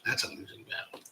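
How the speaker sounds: a hushed, resigned whisper; whispered aside. Real, not acted. Host whispering a rueful aside to chat. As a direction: whisper it to yourself. Repeats remark, then whispers it.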